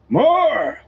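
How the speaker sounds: high, loud roar. A narrator's reading. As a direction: roar the name. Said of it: "The name roared".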